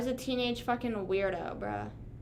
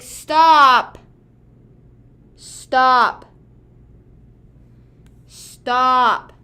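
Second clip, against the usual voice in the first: loud stop; 15 dB louder, much slower than usual. Real, not acted. Annoyed at brother's word.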